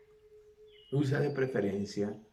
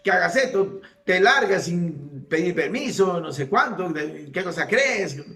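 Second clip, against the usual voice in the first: higher, louder mock scolding of a brat; scolding mother; 9 semitones higher, 7 dB louder than usual. A common mother scolding her son, in a loud mock scold. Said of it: "Una madre común y corriente podría haberle dicho".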